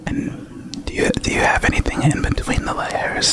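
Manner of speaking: breathy close whisper; ASMR whisper. Acted. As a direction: whisper right into the mic. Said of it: "in A S M R voices".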